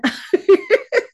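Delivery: a burst of high laughter; sudden high real laugh. Real, not acted. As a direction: laugh out loud, high and bright. Laughing at her own recap.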